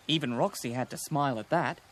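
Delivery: usual speaking voice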